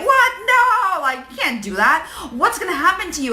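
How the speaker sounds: high, startled voice of the worried brain; alarmed brain. Retold or imitated. Your brain panicking at quitting the job, high startled no.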